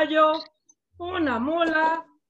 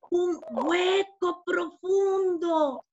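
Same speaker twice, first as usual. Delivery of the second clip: very slow, drawn-out storybook wonder; awed discovery; much slower than usual. Acted. Fly peers into an ear hole, awed. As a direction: stretch each word to show the depth.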